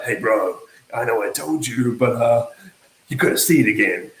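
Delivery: low-pitched, hesitant approach to a cousin; sheepish mock pitch. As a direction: tentatively coax a relative who said no.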